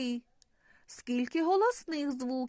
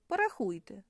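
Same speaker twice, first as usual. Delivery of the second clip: normal talking voice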